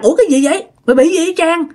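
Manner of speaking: retold startled call to a friend; alarmed questioning. Friends alarmed at Trang's fit. (giọng hỏi hoảng hốt)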